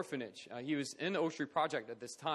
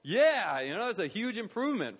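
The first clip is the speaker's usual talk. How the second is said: excited yeah; 7 semitones higher, 5 dB louder than usual. Real, not acted. Cheering the student's improvement.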